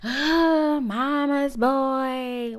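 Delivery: loud gasping mock shock, dragged out slowly. Recapping the bar scene, mocking her cousin as a mama's boy, in loud mock shock. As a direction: gasp loudly and stretch out mama's boy in mock disbelief.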